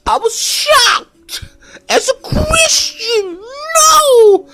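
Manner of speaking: high falsetto whimper, slow; whiny shocked cry. Acted. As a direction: wail it like you're traumatized. Mock-horrified whimper.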